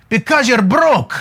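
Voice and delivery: imitated thick Cameroonian French accent; blunt verdict in a put-on French African accent; Guy. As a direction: play the mentor, heavy Cameroonian accent. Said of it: "this real thick French African, uh, accent".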